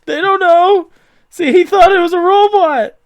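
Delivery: squeaky upbeat nerd voice; high-pitched scientist voice. Acted. Kip, high character voice.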